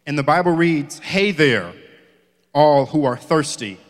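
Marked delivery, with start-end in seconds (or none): 1.0-3.8 scripture reading. Acted. Preacher reading scripture to the congregation, measured. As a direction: read the verses out to the church, deliberately.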